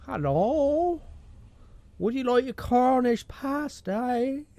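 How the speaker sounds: high, slow put-on Chinese-Cornish accent. Doing a requested accent for friend, Chinese-Cornish accent. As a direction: mix a mock Chinese accent with Cornish, pitched right up. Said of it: "Do a Chinaman that's lived in Cornwall".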